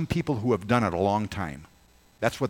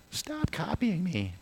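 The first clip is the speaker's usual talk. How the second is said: whiny sibling; 10 semitones higher, 3 dB quieter, slower than usual. Retold or imitated. Kids mimicking at home.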